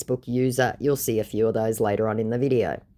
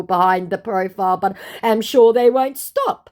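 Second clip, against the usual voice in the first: higher, louder, earnest put-on scammer; mock the fake celebrity's urgent warning; scammer's voice; 8 semitones higher, 4 dB louder than usual. Vlogger voicing the scammer's warning, high and insistent. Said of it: "To which my scammer said".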